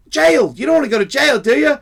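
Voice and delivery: high, loud, as to someone not following; loud insistent warning. Retold or imitated. Helper warns drunk of jail, loud insistent warning. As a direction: get through to a drunk, loudly.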